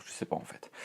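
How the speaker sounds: whispered afterthought, almost to himself; a real whispered aside to the camera. Real, not acted. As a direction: drop to a whisper, thinking aloud. Unsure aside to self.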